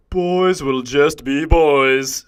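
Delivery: slow, loud mock-speech voice; mocking the shrink. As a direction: quote it slowly in a put-on pompous voice. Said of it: "speech to my parents".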